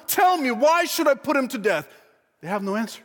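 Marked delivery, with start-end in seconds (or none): 0.1-1.9 high, exasperated demand for a reason; incredulous, raised-pitch challenge; disbelieving demand. Acted. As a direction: plead forcefully with a hostile crowd. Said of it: "he can't believe this".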